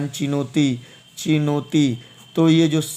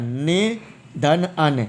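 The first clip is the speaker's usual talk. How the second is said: निधन अन said very slowly, parts apart; slow syllable split; much slower than usual. Shown as a demonstration. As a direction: break it up slowly for the class. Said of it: "slowed split of the word".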